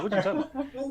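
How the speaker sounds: high, baffled retort; incredulous. Retold or imitated. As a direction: pitch jumps high in disbelief. Incredulous retort to ASMR suggestion.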